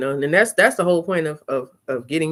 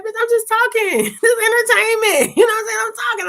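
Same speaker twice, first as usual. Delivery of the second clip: sassy clapback; 6 dB louder than usual. Retold or imitated. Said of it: "I be like".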